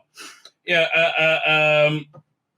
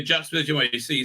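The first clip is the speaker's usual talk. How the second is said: normal talking voice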